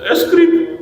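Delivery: lightly mimicked whiny child, pitched high; child fake-crying for a treat. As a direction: imitate a kid begging. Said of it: "Kayak anak kecil, anak kecil kan".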